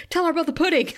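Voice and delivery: imitated background interjection. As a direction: lightly mimic an insistent interrupter. Imitating Anya butting in from background.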